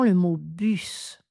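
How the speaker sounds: bus, le S final bien sonore; bus avec S prononcé. Shown as a demonstration. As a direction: dites bus en faisant entendre le S. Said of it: "le S final est prononcé". (bus avec le S final prononcé)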